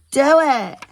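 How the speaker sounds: put-on high, loud child demand; shouted. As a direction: insist at full voice. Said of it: "Skylar shouted".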